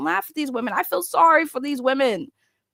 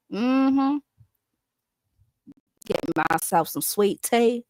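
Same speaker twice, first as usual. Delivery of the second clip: put-on Southern accent; slower than usual. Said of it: "I try to mimic it 'cause it's a beautiful accent".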